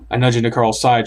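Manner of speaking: ordinary delivery, nothing marked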